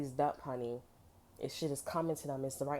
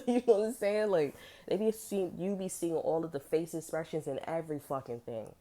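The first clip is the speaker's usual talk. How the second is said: usual speaking voice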